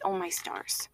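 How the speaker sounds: softly, lightly voiced grandma; a hint of a sweet little grandma, soft; cute grandma voice. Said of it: "in her cute little grandma voice".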